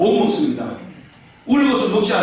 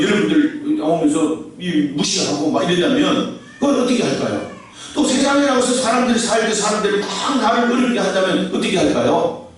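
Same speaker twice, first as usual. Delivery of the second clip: normal talking voice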